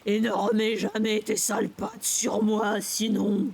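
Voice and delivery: loud, slow, raucous warning; hoarse threat. A narrator's reading. Sister warning brother off, hoarse menacing voice. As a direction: drag out the threat in a strange hoarse voice. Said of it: "Sa voix bizarrement rauque".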